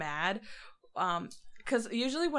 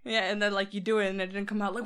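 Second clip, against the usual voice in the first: ordinary talk, nothing marked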